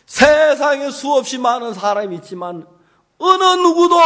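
loud, rising sermon cadence; full-force preaching. Acted. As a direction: preach it out to the whole congregation. (힘차게 외치는 설교)

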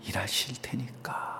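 unvoiced, just breath; whisper. Real, not acted. Pastor whispering his promise to the mother.

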